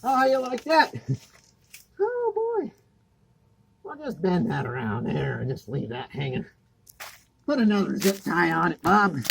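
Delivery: mock-cheerful sarcasm about the sloppy job; dripping with sarcasm, faux approval; sarcastic jab at the installer Bob. Real, not acted. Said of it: "Don't mind my sarcasm".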